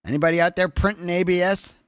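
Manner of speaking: loud, high, quick call-out. Real, not acted. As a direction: throw the question out to everyone listening. Calls out to the stream audience.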